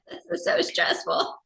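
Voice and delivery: a slow, breathy, whispered little lament; whispered mock stress. Telling a friend about her stressful craft night, whispered. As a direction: hush it like a secret panic.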